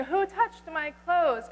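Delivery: Jesus demanding an answer over the crowd, slow and loud; stern demand. Acted. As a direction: call it out over the crowd, slowly. Jesus demands who touched him.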